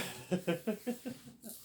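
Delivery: giggling; high laugh. Real, not acted.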